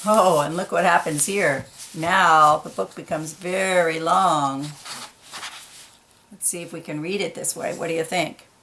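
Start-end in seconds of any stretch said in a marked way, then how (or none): none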